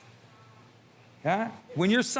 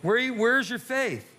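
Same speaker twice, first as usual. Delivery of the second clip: slow challenge; 3 semitones higher, slower than usual. Real, not acted. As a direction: drag it out as a rebuke. Slow rebuke of the crowd.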